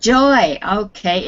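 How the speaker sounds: ordinary talk, nothing marked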